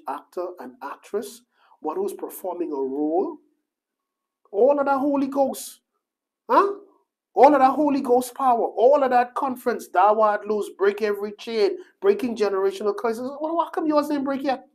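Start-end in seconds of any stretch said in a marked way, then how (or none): 4.5-14.7 high, loud, hammering preacher cry; preaching at full force. Acted. Preacher calling out hypocrites from the pulpit, loud and high. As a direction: drive it home to the church.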